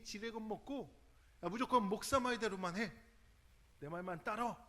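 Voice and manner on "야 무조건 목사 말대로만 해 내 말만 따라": a hint of a domineering pastor barking orders; mock-commanding, insisting on obedience; bossy pastor. Pastor mimicking a controlling minister, mild mock command. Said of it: "이런 식으로 카리스마적이라고 생각하는".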